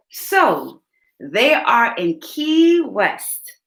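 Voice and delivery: ordinary delivery, nothing marked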